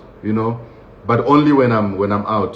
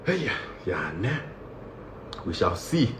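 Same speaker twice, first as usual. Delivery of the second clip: much lower, much softer we shall see; low doubtful mutter; 4 semitones lower, 13 dB quieter than usual. Retold or imitated. Comic's inner doubt about going home, low soft mutter. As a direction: keep it under your breath, unconvinced. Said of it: "I'm sitting there like".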